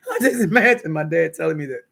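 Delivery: talking through a laugh; words carried on laughter. Real, not acted. Laughing at dad's memory.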